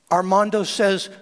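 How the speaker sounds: normal talking voice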